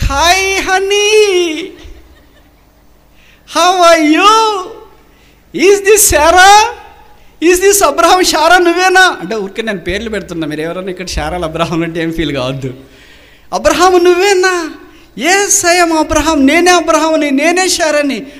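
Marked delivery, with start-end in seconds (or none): 0.0-9.2 much higher and louder, ecstatic disbelief; laughing, shouting joy at finding each other; overjoyed reunion. Acted. Husband and wife reunite at church. 13.5-18.3 much higher and louder, ecstatic disbelief; laughing, shouting joy at finding each other; overjoyed reunion. Acted. Husband and wife recognise each other.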